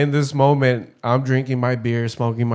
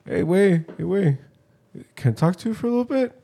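lightly voiced drunk buddy, pitched higher; drunk Mexican caller; 6 semitones higher, 3 dB quieter than usual. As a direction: imitate a tipsy friend asking for a chat. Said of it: "Typical drunk Mexican".

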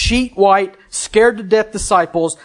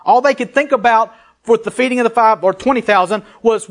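ordinary talk

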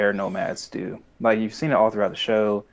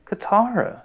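hurt Sokka; 12 semitones higher than usual. Retold or imitated. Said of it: "you can hear it in Sokka's voice".

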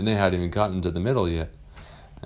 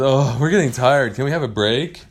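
put-on higher, louder pleading kids' voice; tired kids asking; 6 semitones higher, 7 dB louder than usual. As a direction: beg the teacher for a break. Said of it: "they said".

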